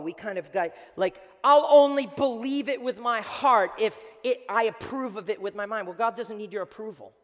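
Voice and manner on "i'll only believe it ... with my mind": mock insistence. Voices doubters setting conditions to God.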